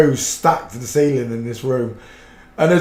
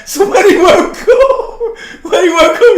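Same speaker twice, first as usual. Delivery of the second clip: laughing hard; 4 dB louder than usual. Real, not acted. Starting the chopped liver tale, laughing hard. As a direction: barely get it out for laughing.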